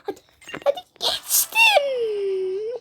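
slow, high whimpering cry; crying plea. Real, not acted. Drawn-out crying plea. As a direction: gamer begging herself across, half-crying. Straining to clear the jump.

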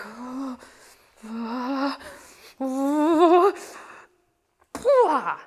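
separated "ah"s, held back, then exploding; slow boil building. Shown as a demonstration. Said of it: "can also be like".

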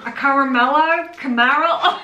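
put-on laughing, botched Australian accent; fumbling the Aussie accent through a laugh. Mangling the name in a fake Australian accent, laughing. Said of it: "say that in an Australian accent".

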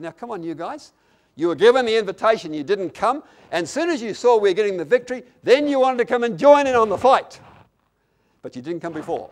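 indignant retort building to loud and high, then dropping; hitting back indignantly. Acted. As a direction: fire back an accusing comeback. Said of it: "I would have hit back".

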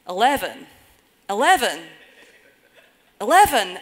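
put-on rising loud Scottish accent shouting. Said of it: "saying in very Scottish accents".

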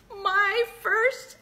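high, loud, thrilled woohoos; real overjoyed cheering over the chips; excited cheer. Real, not acted. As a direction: celebrate your first batch out loud. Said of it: "I'm very excited".

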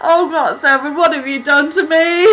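overwhelmed cry. Real, not acted. Overwhelmed fan reaction.

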